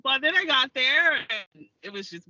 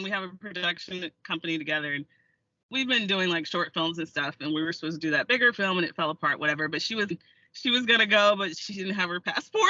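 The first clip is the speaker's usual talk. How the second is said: usual speaking voice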